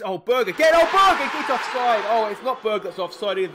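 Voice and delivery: high, loud cry at a near goal; excited shout. Real, not acted. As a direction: yell as the chance goes in. Near goal then offside call.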